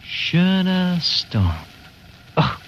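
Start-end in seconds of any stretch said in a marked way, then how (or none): 0.0-1.5 extremely slow, lingering Schöner Storm; savoring the name. Acted. Publicist urges the skipper to hear the name sing, very slowly. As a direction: let Schöner Storm sing, stretching each syllable. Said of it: "does it sing for you?".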